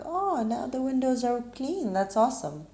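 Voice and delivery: light, high voice of sudden reassurance; relieved. Retold or imitated. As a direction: sigh of "oh" turning into pleased relief. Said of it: "I started feeling relief".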